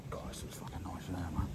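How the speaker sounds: hushed whisper of delight. Real, not acted. Whispering to himself by the lake, hushed whisper. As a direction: breathe it out barely voiced. Quiet aside while by the water.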